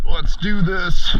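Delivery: loud, high, drawn-out rallying call; psyched up. Real, not acted. Diver about to get into the water, psyched up. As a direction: draw it out loud and high to launch the dive. Hyping up before the dive.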